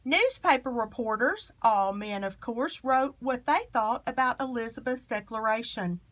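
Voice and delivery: usual speaking voice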